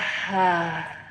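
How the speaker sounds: long open-mouthed sigh out; audible sigh. Shown as a demonstration. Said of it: "And exhale".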